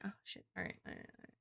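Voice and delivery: hushed, cowed whisper; sheepish whisper. Retold or imitated. Soda caving after being yelled at, whispering. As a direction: give in meekly under breath. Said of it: "And I'll be like".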